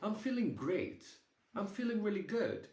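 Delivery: lightly voiced grandmother, pitched high; cute elderly grandmother. Grandmother reassuring grandchild from hospital, lightly imitated high cute voice. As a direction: imitate grandma insisting she's doing well, high. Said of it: "just the elderly, cute grandmother".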